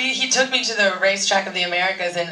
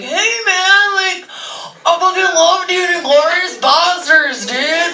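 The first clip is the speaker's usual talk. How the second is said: higher, louder bro-fan gush; excited fan; 9 semitones higher, 6 dB louder than usual. Retold or imitated. Fan gushing at the actor, loud and higher. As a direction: hype it up like a starstruck fan. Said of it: "fans would come up to him and be like".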